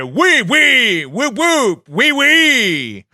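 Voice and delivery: high, slow wrestling promo voice; Marky Extreme. Acted. Showing how a wrestler would say the catchphrase, high hype voice. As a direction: drawl it big and end on high whoops. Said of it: "this is how Marky Extreme would say it".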